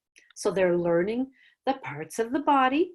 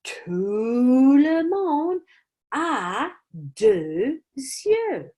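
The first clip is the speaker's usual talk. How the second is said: slow, rising, ending much higher; dramatic reveal; 4 semitones higher, 4 dB louder, much slower than usual. Shown as a demonstration. Teacher dramatizing the clue for students, slow with a high finish. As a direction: build suspense and land it high.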